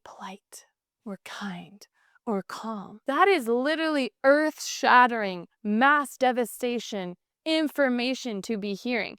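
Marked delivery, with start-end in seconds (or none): 0.0-3.0 hushed whisper. Real, not acted. Speaker telling couples how betrayal feels, whispered. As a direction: drop to a soft whisper. Stresses raw reaction to betrayal.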